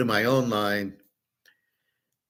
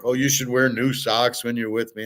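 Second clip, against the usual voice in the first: normal talking voice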